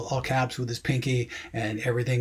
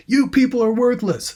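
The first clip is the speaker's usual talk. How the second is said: much higher, louder indignant yell; acted yell; 11 semitones higher, 6 dB louder than usual. Character berating the campus police, acted high yell. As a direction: go back into yelling the script line. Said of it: "So she goes back into the yelling again".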